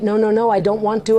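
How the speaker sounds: ordinary talk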